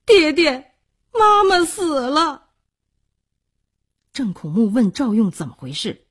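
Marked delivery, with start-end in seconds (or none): 0.1-2.5 high, loud, tearful wail; drawn-out, high-pitched sobbing plea; child crying out. Acted. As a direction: cry out to father in a child's high voice. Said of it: "哭道".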